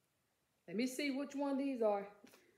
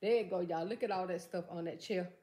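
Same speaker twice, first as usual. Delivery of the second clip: ordinary delivery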